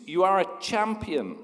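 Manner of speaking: a high call across the mountain; a father shouting praise; shouted encouragement. Acted. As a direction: shout it out proudly. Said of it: "Again, the man screams".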